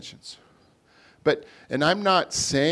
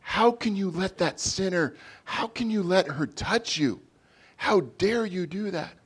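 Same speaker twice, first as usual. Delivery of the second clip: higher, scandalized crowd voice; mock the shocked accusers; indignant gossiping bystanders; appalled onlookers; 6 semitones higher than usual. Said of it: "Everybody is like".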